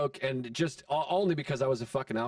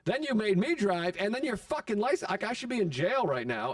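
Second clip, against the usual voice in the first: acted-out high-pitched argument; indignant rant; 5 semitones higher than usual. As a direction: pile up the accusations, pitch raised in protest. Acted quarrel with angry buddy.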